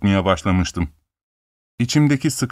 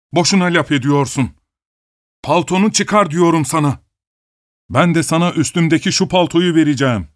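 gruff robber voice; 6 semitones higher than usual. A narrator's reading. Robber orders coat off.

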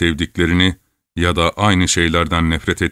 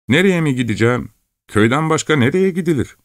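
put-on higher, fast, puzzled young voice; surprised village lad; 10 semitones higher, faster than usual. A narrator's reading. Lad answering Chelkash's question, fast high surprise. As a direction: blurt it out, quick and high.

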